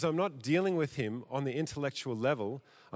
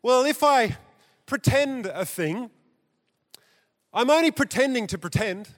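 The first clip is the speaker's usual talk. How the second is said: higher, sly mock-innocent quote; mocking Derrida; 7 semitones higher, 5 dB louder than usual. Preacher mocking Derrida for congregation, higher sly quote. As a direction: toss it off like a slippery excuse. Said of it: "He says".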